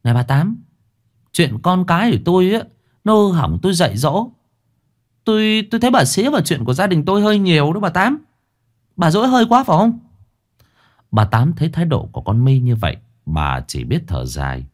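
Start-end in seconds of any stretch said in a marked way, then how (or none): 0.0-10.0 high, fast sneering retort; mock the old neighbour with a smirk; mother sneering back at the meddling neighbour. A narrator's reading. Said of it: "Ả nhếch môi".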